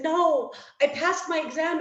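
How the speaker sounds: high, loud retold cry of relief; mock tearful wail. Wife telling husband she passed, imitated tearful outburst. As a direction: imitate blurting it out while crying, voice pushed up. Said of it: "I'm, like, ugly crying".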